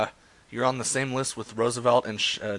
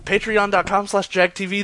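announcer plug; 9 semitones higher, 6 dB louder than usual. Acted.

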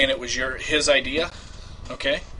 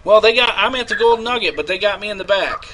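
desperate; 5 semitones higher, 4 dB louder than usual. Acted. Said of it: "I will play me".

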